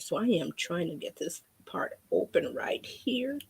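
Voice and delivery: whispering to herself while opening the bottle; hushed aside under her breath. Real, not acted. Crafter whispers to herself while working the cap open, hushed.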